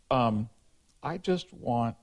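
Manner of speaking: ordinary talk, nothing marked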